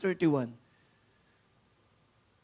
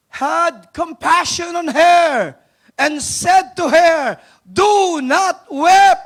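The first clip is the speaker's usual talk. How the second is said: very slow and much louder, every word drawn out; slow, loud proclamation; 9 semitones higher, 12 dB louder, much slower than usual. Acted. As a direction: proclaim Jesus' comfort with force. Reads Jesus' words with fervour.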